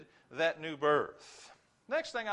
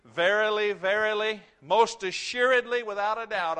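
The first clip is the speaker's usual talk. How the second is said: louder, ringing out each word in a climb; building loud proclamation; 4 semitones higher, 8 dB louder than usual. Acted. Preacher voicing Jesus' warning to the church, loud and insistent. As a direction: hammer it home like a flashing neon sign. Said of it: "shining a light bulb on this and saying".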